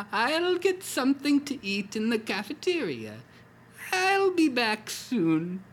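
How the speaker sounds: a wife stepping out kindly; wife's voice. Acted. Wife to dying husband, high and gentle. Wife leaving hospital room.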